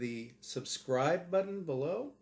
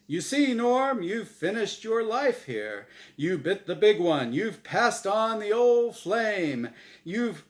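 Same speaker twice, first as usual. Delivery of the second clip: much higher, loud showman energy; hyped game show host; 8 semitones higher, 8 dB louder than usual. Acted. Host insisting this is real, wild showman hype. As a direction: hype it up like live TV.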